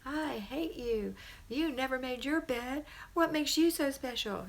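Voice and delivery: high, drawn-out angry taunts; hammed-up tantrum insults; mock mad child. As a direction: imitate a child lashing out, slow and high-pitched. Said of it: "She's just mad at herself".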